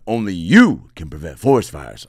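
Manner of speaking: lightly voiced commercial mascot, heavy emphasis on "you"; hint of a public-service announcer; Smokey the Bear. Imitating a bear in an old commercial, lightly voiced slogan. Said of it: "Smokey was like".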